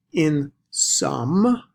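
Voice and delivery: some lifted with a rising tune; spoken emphasis on some; stress on some. Shown as a demonstration. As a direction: hang on the word some and let it rise. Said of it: "I'm trying to emphasize this verbally".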